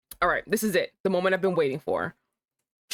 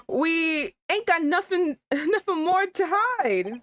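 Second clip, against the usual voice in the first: slow, higher London voice; higher Cockney witness; 9 semitones higher, slower than usual. Acted. Witness protesting innocence in a higher Cockney voice. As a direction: insist slowly you've nothing to hide.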